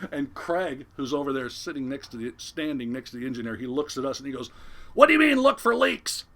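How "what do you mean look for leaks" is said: high, loud outraged shout; incredulous yell. Retold or imitated. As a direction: explode at the crewman in disbelief. Skipper reacts in alarm, acted.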